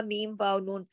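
ordinary talk

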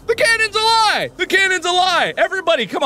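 high, loud rallying cry; shouting. Acted. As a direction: proclaim it like a battle cry. Rallying the audience against theory.

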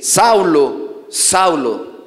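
slow, drawn-out call of the name, falling; a solemn divine summons, lightly voiced; voice from heaven. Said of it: "oyó una voz que le decía".

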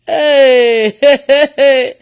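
loud, repeated cries of hey; big drawn-out whoop, then repeats; exultant shouting. Real, not acted. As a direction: cry out in elated amazement. Exulting at viewers' reported reactions.

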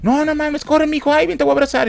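high, squeezed panicky parent; hammed-up alarm without raising the volume; mock panic. Parent calling child over after a scream, mock panic.

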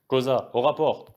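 loud commanding bark; barked order. A narrator's reading. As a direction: demand a report at full voice. Officer barks order at Kosa. (ordre aboyé d'une voix forte)

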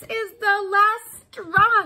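high, loud, slow angry cry; mock yell. Woman fed up with her animals, yelling. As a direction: bellow it like she's fed up. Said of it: "she yells".

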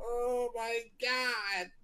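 slow, soft moan of grief; drawn-out despair; grieving wail. Acted. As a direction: wail over a lost pet. Owner mourns his pet penguin.

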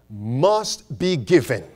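usual speaking voice

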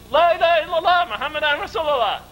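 high, fervent answering cry; retold group shout. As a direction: answer the call as a whole crowd would. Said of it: "Again we shouted it".

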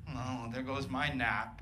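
low, slow, soft sigh of a line; mock a put-out passenger, low and slow; resigned grumble. Single guy on a plane sees a crying toddler, resigned grumble.

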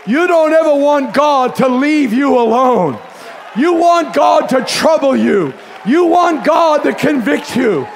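shouted, high sermon climax; a preacher shouting at the congregation; full-force preaching. Acted. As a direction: preach it hard to the crowd.

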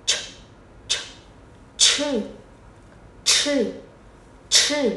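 "ch" thì thầm bật mạnh hơi; "ch" đẩy hết hơi ra, không thanh. Shown as a demonstration. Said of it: "quay trở lại với âm này". (phụ âm "ch" bật hơi kiểu tiếng Trung)

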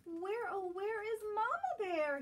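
high, carrying little cub call; Baby Bear calling. Acted. Baby Bear looking for Mama at the den, calling high. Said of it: "he calls".

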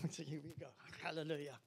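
ordinary talk

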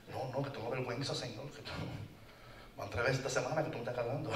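lightly voiced sheep, a touch embarrassed; a hint of shy sheep. The sheep to God, a lightly voiced shy excuse. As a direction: rush the excuse a little sheepishly. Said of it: "Con vergüenza".

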